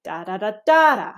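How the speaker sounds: "da da da DA da" ending in a fall; falling intonation. Shown as a demonstration. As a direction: hum the tune in "da", peaking late and dropping. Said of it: "you can actually fall".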